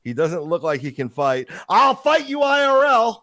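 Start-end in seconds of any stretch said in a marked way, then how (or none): 1.7-3.2 much higher, louder, drawn-out taunt; hammed-up blustering challenge, high and slow; mock tough guy. Co-host imitates tough guy's threat, high mocking bluster.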